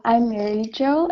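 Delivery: ordinary talk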